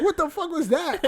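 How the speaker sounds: high, amused voice of mom; mom teasing. Retold or imitated. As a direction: ask it like a laughing mother. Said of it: "She was like".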